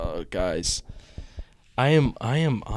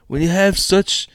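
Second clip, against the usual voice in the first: slow, higher mock Fauci voice; Doctor Fauci impression; 8 semitones higher, 5 dB louder than usual. Host testing a Fauci impression, slow and higher. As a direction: ease into a put-on Fauci voice. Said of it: "Let me see".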